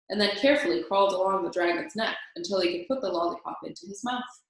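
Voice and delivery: ordinary delivery, nothing marked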